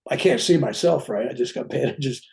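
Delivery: ordinary delivery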